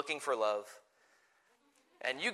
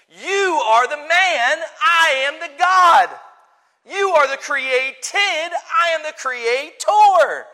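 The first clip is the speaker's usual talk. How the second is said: loud, high, slow shouted proclamation of God's authority; preaching at full force; 9 semitones higher, 11 dB louder than usual. Acted. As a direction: thunder each line like God putting man in his place. God rebukes man, preached.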